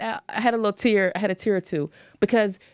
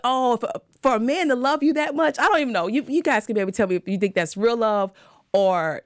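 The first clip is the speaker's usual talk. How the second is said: ordinary delivery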